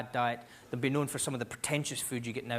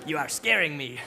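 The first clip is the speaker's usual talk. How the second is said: put-on French accent, dropping lower at the end; 4 semitones lower than usual. Scot and French tourist scaring each other, French accent. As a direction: back down in a mock French accent. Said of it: "with a slight French accent".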